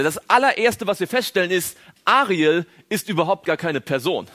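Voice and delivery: normal talking voice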